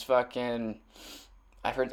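usual speaking voice

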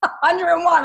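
amazed echo. Real, not acted. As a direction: echo it back in laughing disbelief.

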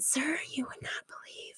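hushed, secretive reply to a stranger; soft, conspiratorial whisper; whispered retort. Retold or imitated. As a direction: whisper it like a confession. Quoted reply to neighbour.

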